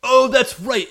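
loud, high burst of realisation; hyper energetic. Retold or imitated. Kyosuke suddenly remembers his duty, hyper energetic. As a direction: act out a hyped-up hero jolting into action. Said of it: "he is hyper energetic now".

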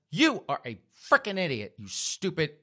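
high, punched-out fury; enraged insult. Acted. Girlfriend insulting her boyfriend, enraged. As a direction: berate him word by word. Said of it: "she got just enraged at him".

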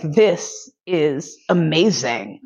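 slow, low, drawn-out savouring; savouring slowly. Retold or imitated. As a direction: let each word melt, blissed out. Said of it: "And I was like".

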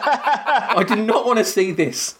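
high-pitched outraged mock shout. Imagined viewer yelling at the film's final reveal, mock shouting. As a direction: act out yelling at the screen in protest. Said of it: "I'd be shouting and going".

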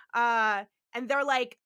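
normal talking voice

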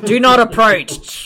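loud, drawn-out mock warning; warning growl. Warning people off like an angry rattlesnake, mock menacing. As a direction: play a slow, loud warn-off. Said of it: "to warn people when we're really fucking angry".

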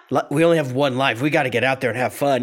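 fast, lightly voiced pep talk; mock pep talk. Simon urging his phone-bound friends, fast mock pep talk. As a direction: rattle it off as a quick rallying cry. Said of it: "and say".

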